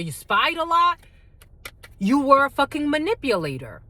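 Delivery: ordinary talk, nothing marked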